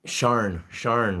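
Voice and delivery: usual speaking voice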